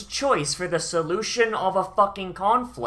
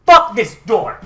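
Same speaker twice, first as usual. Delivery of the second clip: Rack imitated, a loud higher shout; mock angry yell; 4 semitones higher, 11 dB louder than usual. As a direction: bellow it like you're kicking the door in. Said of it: "Rack just goes".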